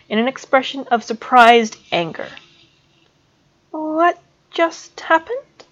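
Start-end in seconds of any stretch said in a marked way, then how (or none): 3.7-5.6 put-on lightly higher, slow bewildered girl; confused Rigel. A narrator's reading. Girl asks Snape, a touch of bewildered voice. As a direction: ask it dazed and lost.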